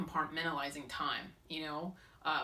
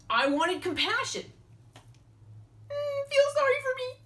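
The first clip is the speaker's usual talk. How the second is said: whiny kitten voice; 13 semitones higher, 6 dB louder than usual. Acted. Mocking needy self with meows.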